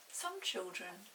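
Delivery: ordinary delivery, nothing marked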